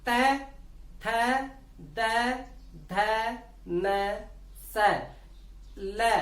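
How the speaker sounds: त, थ, द, ध, न, स, ल with the tongue touching the teeth; slow dental syllables त थ द ध न स ल for students; dental sounds demo. Shown as a demonstration. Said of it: "उच्चारण करके देखिए आप".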